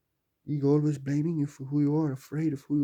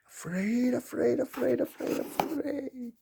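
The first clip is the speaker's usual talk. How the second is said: mock panicked repetition that climbs higher; building fear; 10 semitones higher than usual. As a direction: let the panic rise word by word. Repeating afraid, building up.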